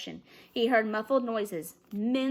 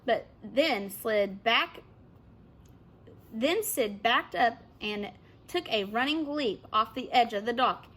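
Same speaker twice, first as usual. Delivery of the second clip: usual speaking voice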